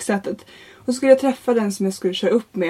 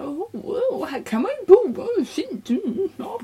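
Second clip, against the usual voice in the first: higher, softer mock admiration; high, admiring examiner; 8 semitones higher, 4 dB quieter than usual. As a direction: coo over the nice houses as the examiner. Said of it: "så då körde jag lite".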